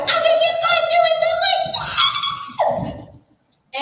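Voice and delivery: put-on high, loud child's shout; little boy. Little boy shouting along to a song, high and loud. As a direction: yell it like an excited little kid. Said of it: "your little boy's like".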